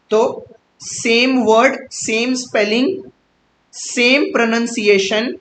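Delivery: each "सेम" drawn out slowly, rising at the end; slow stressed definition. Shown as a demonstration. Teacher dictating the rule to students, slow and emphatic. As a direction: spell out the rule slowly, word by word. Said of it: "teacher dictating homograph definition".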